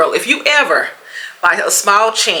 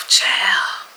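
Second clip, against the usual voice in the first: breathy whispered aside. Retold or imitated. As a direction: whisper it in exasperation. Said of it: "And I was like".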